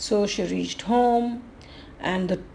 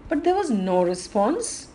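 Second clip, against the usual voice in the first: usual speaking voice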